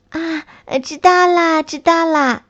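put-on high, cheerful child voice; eager "got it" from a child; happy little snail.

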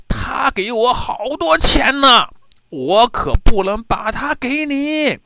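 high, loud defiant reply; calling back. A narrator's reading. Fisherman refusing the soldiers on shore, high loud calling.

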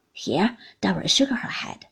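usual speaking voice

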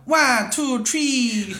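very slow, high Jamaican "one two three"; Jamaican accent. Retold or imitated. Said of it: "那个牙买加话叫".

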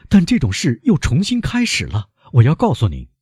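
high, urgent plea; pained, high-pitched outburst; anguished. A narrator's reading. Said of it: "可怕的痛苦呈现在莫雷尔的脸容上".

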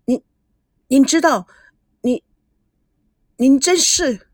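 stammering in shock, pitch jumping up; stunned stammer. A narrator's reading. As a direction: trip over the words, dumbfounded. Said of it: "赵熙惊呆了". (惊呆结巴)

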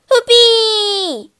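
loud, high whoop of joy; thrilled fairground yell; delighted whoop. A narrator's reading. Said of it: "He whooped with delight".

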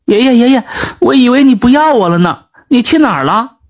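put-on a child crying out loudly. A narrator's reading. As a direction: call out to grandpa through tears. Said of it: "大声哭道".

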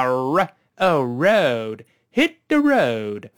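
慢速示范road，开头R勾起舌尖、拉长; 把hit the road里的R勾舌读足，放慢; R要勾舌. Shown as a demonstration. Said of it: "这字母R确实要勾舌啊". (road开头勾舌卷R，慢慢拖长)

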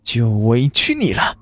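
lightly voiced malicious woman; understated malice, slowing on the last words; vicious. A narrator's reading. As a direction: threaten with mild venom, lingering at the end. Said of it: "她恶毒地说".

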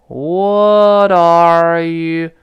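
"What are you" stretched very long in one linked glide, loud; slow-motion breakdown. Shown as a demonstration. Teacher breaking down the mouth movement, very drawn-out. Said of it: "你看我再分解动作".

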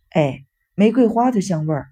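ordinary delivery, nothing marked

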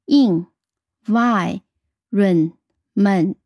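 in, y, ren, ment spoken as separate syllables with pauses; teacher counting the syllables aloud; split into syllables. Shown as a demonstration. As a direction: break the word into its four beats. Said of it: "一共有四个音节".

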